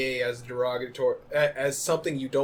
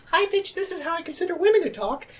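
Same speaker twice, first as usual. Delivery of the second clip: much higher, put-on female voice; mock woman's voice; 13 semitones higher than usual. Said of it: "he doesn't go like".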